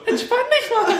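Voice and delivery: put-on high, giggly kids chanting together; high child chorus voice. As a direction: chant it like a room of small kids. Kids' group chants the phrase.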